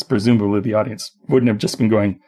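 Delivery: ordinary delivery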